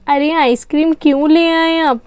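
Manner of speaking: ordinary talk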